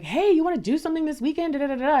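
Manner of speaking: high, quick imitation of a keen friend; eager mock invite. Voicing an eager flaky friend's invite.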